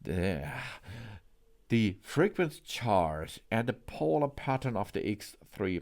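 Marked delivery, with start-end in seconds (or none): none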